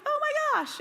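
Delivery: high mock dismay; a squeaky shocked cry; oh my gosh. Mom reacting to the grocery bill, mock shock.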